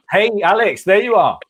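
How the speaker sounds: high, bright greeting as Alex appears; calling out to Alex. Real, not acted.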